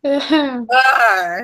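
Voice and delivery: drawn-out mock complaint; loud, stretched teasing scolding; playful exasperation. Real, not acted. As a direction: groan it out slowly at a teasing friend. Playful scolding after a teasing pick.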